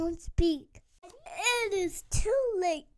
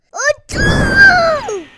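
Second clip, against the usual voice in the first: sneeze building; 12 semitones higher, 15 dB louder than usual. Acted.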